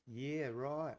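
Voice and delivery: soft, playful Aussie imitation with swooping pitch; lightly mocking Australian listener murmurs; Australian accent. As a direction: mimic Australian mates chiming in, "roit". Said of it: "they're like".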